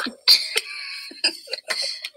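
shrieking high-pitched giggle; high laughing fit. Real, not acted. A child cracking up at a funny face, squealing.

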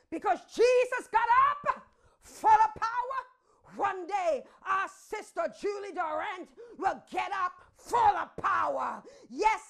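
loud, whooping preacher's climax; preaching at full force. Acted. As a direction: shout the promise to the church.

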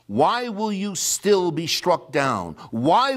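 prophet's rebuke from the pulpit; fiery preaching. Acted. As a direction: hurl the accusations at the nation. Scripture read in rising preaching.

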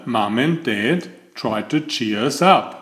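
ordinary delivery

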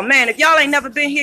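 usual speaking voice

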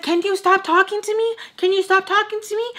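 high, heated demand, re-enacted; so mad. Telling brothers' dad to back off, mad. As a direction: demand it twice, voice pitched right up. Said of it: "'Cause I was so mad".

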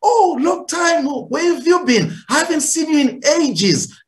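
high-pitched, gushing greeting to strangers; pleasant, positive tone. Acted. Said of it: "I mean, positive tone".

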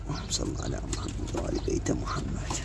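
whispering the blessing under breath; barely voiced whispered prayer. Real, not acted. Blessing while showing birds.

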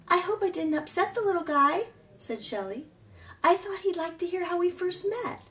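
a lightly voiced chatty otter. A narrator's reading. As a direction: sound like a cheerful otter. Shelley the otter speaking.

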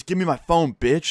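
high-pitched retold demand; angry demand. Student tries to grab phone from teacher, angry demand. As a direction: act out a kid demanding it back. Kid grabs for his phone.

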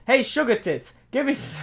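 put-on high, loud Boston accent; brash Boston guy voice. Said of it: "repeat that process in a Boston accent".

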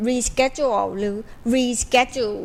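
reschedule the American way, "ree-SKED-jool"; American reschedule with a hard SK; American pronunciation. Shown as a demonstration. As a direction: show the American variants of reschedule with sk. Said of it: "ส่วนใหญ่แล้ว เก้าสิบเปอร์เซ็นต์ ก็คือสำเนียง".

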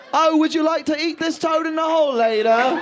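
high, dainty mock-posh invitation; hammed-up refined voice; prissy posh offer. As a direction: offer it like a fussy host.

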